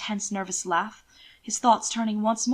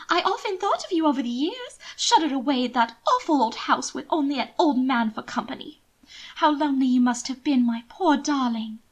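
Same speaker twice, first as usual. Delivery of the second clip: higher, gushing lady; raised noblewoman's voice building to delight; Mina's voice; 5 semitones higher, 4 dB louder than usual. Acted. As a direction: pour on sweet pity, then glee. Narrator voices Mina.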